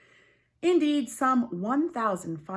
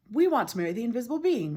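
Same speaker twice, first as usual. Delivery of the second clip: eager sisters; 4 semitones higher, 5 dB louder, much faster than usual. Acted.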